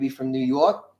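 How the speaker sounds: hint of a New York accent. Shown as a demonstration. Showing viewers a New York American accent. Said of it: "delineate American accents".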